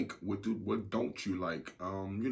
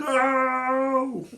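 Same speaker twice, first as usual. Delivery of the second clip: loud, high, drawn-out wail; mock outcry; 12 dB louder than usual. Co-host cutting off the put-on answer, loud drawn-out wail. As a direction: belt out a long, held protest.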